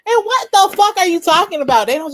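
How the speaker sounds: loud, high disbelief; incredulous. Real, not acted. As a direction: blurt it out in shock. Disbelief at story.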